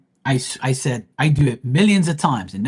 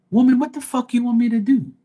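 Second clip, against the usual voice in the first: much higher, louder angry snap; exasperated outburst; 10 semitones higher, 4 dB louder than usual. Real, not acted. Fed-up reader yelling at a demanding viewer, much higher and louder. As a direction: blow up at her in real frustration.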